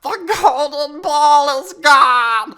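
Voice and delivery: loud wailing shout of loss; distraught cry. Acted. Storyteller wailing as the ball drops in the water.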